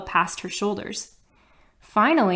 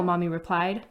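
ordinary delivery, nothing marked

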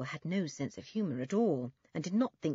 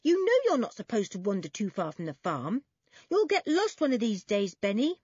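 raised, louder warning; stern, higher-pitched telling-off; stern older brother; 4 semitones higher, 5 dB louder than usual. Acted. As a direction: scold a small brother who has wandered off. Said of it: "said Toby sternly".

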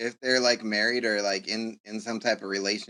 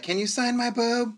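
high, breathy fan request; high girly voice; 11 semitones higher than usual. Retold or imitated. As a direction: ask it high like a giddy fan. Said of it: "she's like".

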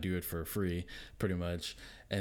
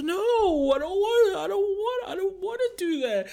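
much higher, louder mock kid whine; whining child; 8 dB louder than usual. Daughter refusing a handicap, mock whining. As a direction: wail it like a sore loser.

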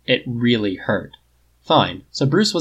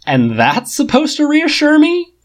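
high, loud, slow sneer; sarcastic retort after a bark of laughter; scoffing; 7 dB louder, slower than usual. A narrator's reading. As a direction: scoff in disbelief.

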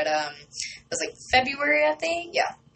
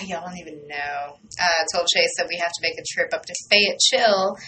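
ordinary talk

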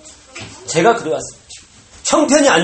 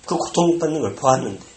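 usual speaking voice

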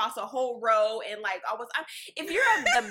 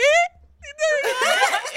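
very high, loud shriek with laughter; loud falling squeal, hammed up; imitated shriek; 10 dB louder than usual. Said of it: "that's literally how it sounded".

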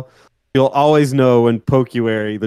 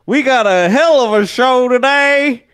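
hype announcer; 10 semitones higher, slower than usual. Pretend. Hyped show intro.

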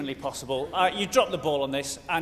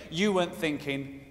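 ordinary delivery

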